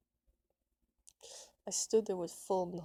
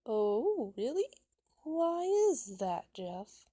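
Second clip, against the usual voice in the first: high, loud, drawn-out mock surprise; smart-ass; 4 semitones higher, 7 dB louder, slower than usual. A narrator's reading. Ben needles Jeff to explain himself, smart-ass. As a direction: swoop up sarcastically, dropping back on the name. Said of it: "starting to get a smart-ass tone".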